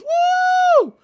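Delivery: shouted high whoop, cheering on; crowd cheer whoop. Retold or imitated. Citizens cheering the inmates on, shouted whoop. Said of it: "civilian goes".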